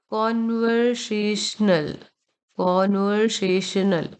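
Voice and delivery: कॉन्वर्सेशनल stretched out, 'kon-ver-say-shnul'; careful, slow teacher model of कॉन्वर्सेशनल; slow model pronunciation. Shown as a demonstration. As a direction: draw out each syllable of कॉन्वर्सेशनल. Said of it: "ये pronounce करने में मदद करेगा".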